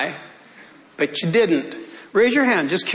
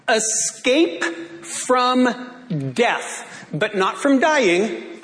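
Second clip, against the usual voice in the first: very slow, drawn-out preached phrase; slow pulpit emphasis; preaching; much slower than usual. Acted. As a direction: let it ring out slowly. Preached line, slowed.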